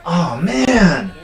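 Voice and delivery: ordinary talk, nothing marked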